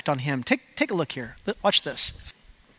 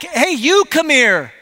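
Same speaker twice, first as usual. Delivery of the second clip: loud, slow call back, pitched up; yelling back; 4 semitones higher, 4 dB louder, slower than usual. Retold or imitated. Dad yelling back to his son from another room, slow and loud. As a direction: call back to the kid without getting up. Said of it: "And so I yell back".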